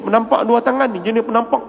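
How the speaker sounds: usual speaking voice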